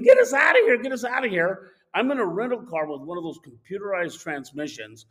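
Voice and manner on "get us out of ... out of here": high, loud scream easing off; imitated screaming. Wife to husband at the wheel, imitated screaming. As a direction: mimic her frantic scream, then let it drop. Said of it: "my wife's screaming".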